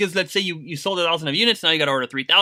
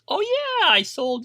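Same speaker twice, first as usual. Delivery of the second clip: very high, drawn-out show-off voice; mock bragging; 14 semitones higher, 4 dB louder, slower than usual. Someone in the Amazon space showing off sales, mock bragging. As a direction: put on a loud-mouthed boaster, pitched way up. Acted bragging seller among newcomers.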